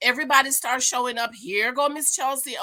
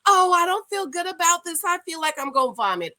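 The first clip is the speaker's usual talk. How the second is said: mock a queasy complainer in a raised voice; Chelsea mimicry; 7 semitones higher than usual. Recapping a cast member who feels sick, mock whining. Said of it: "what does Chelsea say".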